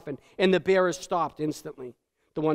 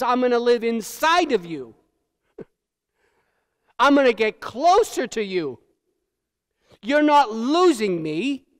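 fervent Jesus promise; 4 semitones higher, 5 dB louder than usual. Acted. Preacher voices Jesus' promise.

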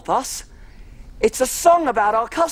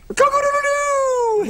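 high, long rooster crow. Pretend. Making farm animal noises, a high rooster crow. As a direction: crow it out like a cockerel. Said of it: "I'm making farm animal noises".

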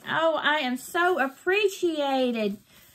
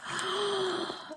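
soft, high, long gasp; amazed gasp; 7 semitones higher, 7 dB quieter than usual. Real, not acted. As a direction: gasp in delighted disbelief. Sees the gift.

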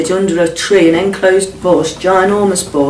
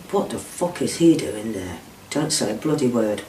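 menacing low threat; 5 semitones lower, 9 dB quieter than usual. Retold or imitated. Kidnapper threatening hostage.

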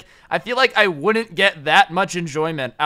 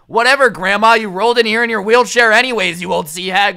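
loud, higher-pitched rude jeer; mock yelling; 4 semitones higher, 7 dB louder than usual. Angry truck guy to old lady in wheelchair, mock yelling. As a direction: yell it dismissively, imitating a rude guy. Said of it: "don't yell at the old lady".

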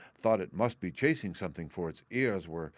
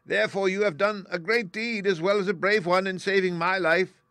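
high, loud, regal little squeak; squeaky mouse queen; 11 semitones higher, 7 dB louder than usual. A narrator's reading. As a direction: declare it royally in a tiny mouse voice. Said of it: "in a squeaky little voice".

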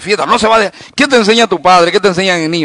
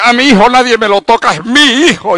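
indignant parent, pitch raised; overprotective parent; 5 semitones higher, 4 dB louder than usual. Retold or imitated. Preacher mimics parents defending their son, higher indignant voice. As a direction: defend your child, a touch shrill and higher. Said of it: "Y los padres".

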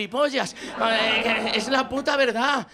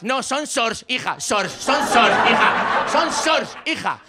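hammered-home retort, building louder; mock-exasperated correction; 4 dB louder, much slower than usual. Father repeating daughter's word back at her, mock exasperation. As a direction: throw the word back, ever more emphatically.

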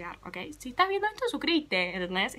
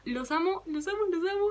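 gushing; 8 semitones higher than usual. Real, not acted. Sign-off to her followers.

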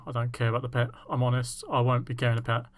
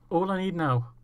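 normal talking voice

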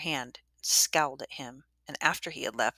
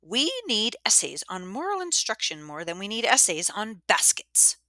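stiff, higher, rigid pronouncement; 6 semitones higher, 4 dB louder than usual. Acted. Missus Dwyer rejecting the essay to Miss Weston, stiff. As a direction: lay down the rule with no give. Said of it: "In a very stiff voice".

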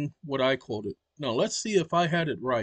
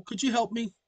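ordinary talk, nothing marked

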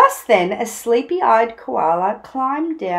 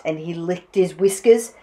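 plain reading voice

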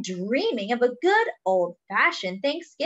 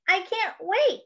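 high-pitched, eager pig; crying out; 11 semitones higher than usual. Acted. Pig invited to Thanksgiving dinner, crying out eagerly. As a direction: exclaim it in eager anticipation. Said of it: "cried Pig".

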